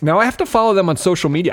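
ordinary talk

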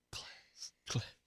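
whispered fading echo; cave echo. Pretend. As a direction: let it fade like an echo.

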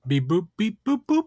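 beeping robot, pitch jumping up. Acted. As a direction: beep and boop like a toy robot. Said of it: "three robots of varying size and shape".

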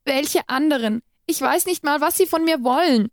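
high, desperate questioning. A narrator's reading. Bound captive pleads with her captor, desperate and high. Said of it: "also fragte ich verzweifelt".

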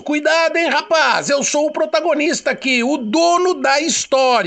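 threatening. Acted. Threatens the narrator.

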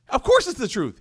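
high, emphatic rebuke; telling off. Acted. Abu Jahl answering his doubting friend, telling him off. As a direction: put him in his place for asking. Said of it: "he tells the other guy off".

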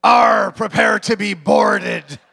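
loud, slow pirate shout. Retold or imitated. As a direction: bellow it like a pirate captain.

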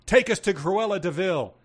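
a touch of a bark, punched from the first word; barked order. A narrator's reading. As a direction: bark it out like a demand. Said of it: "and barked".

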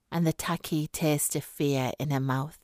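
ordinary delivery, nothing marked

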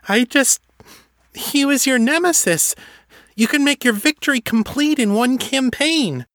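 high nervous elf voice; a flustered servant elf, pitched high; alarmed elf. Acted. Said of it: "her hands raised in alarm".

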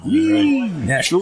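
drawn-out Nashville. Real, not acted. Cheering for Nashville.

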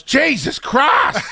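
high, drawn-out shout; shocked exclamation. Retold or imitated. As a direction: blurt it out in shock. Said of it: "He goes".

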